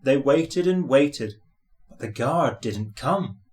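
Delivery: plain reading voice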